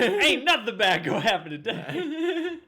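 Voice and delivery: high, loud, laughing bravado; hammed-up confident hero; mock hero voice.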